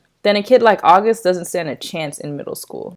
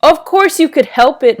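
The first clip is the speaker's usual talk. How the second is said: scolding; 8 semitones higher, 5 dB louder than usual. Acted. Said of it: "Mom scolded".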